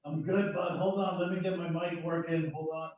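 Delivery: distant, echoing bad mic; cave echo. Real, not acted. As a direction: voice from far off in a cave. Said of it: "you sound like you're in the biggest, uh, cave of all".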